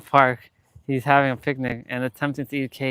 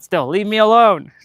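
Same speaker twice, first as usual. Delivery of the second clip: higher and louder, whiny shoo; exasperated shooing; 7 semitones higher, 7 dB louder than usual. Real, not acted. Vlogger telling flying bugs to leave her alone, loud whiny plea. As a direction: shoo them off, half-laughing.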